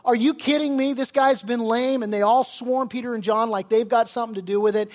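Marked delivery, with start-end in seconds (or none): 0.1-2.0 louder, higher mock amazement; astonished. Preacher voicing the temple crowd seeing a lame man walk, amazed. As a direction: voice a crowd losing it, lightly acted. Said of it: "everybody in the temple just loses it".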